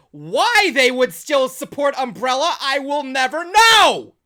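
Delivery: loud, slow, building exasperation; exasperated rant. Real, not acted. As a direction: belt it out slowly, stretching the last word. Ranting about Umbrella on show.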